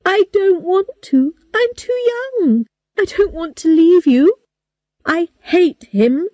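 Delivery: pleading, voice high and cracking into tears; crying. A narrator's reading. Girl begging her father not to marry her off, crying. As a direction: beg through tears, pitch high. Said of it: "I started crying".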